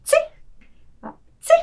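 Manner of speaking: short, high mock sneeze; demonstrated sneeze. Shown as a demonstration. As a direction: fake two little "tsi" sneezes. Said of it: "this is sneezing".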